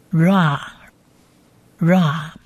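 ra repeated very slowly, r plus long a; pronunciation demo of RA. Shown as a demonstration. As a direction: drill ra, ra, ra with spaces between and a long a. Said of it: "合在一起发R A的音". (慢速示范ra，r接长音a，逐个重复)

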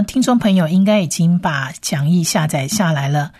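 ordinary talk

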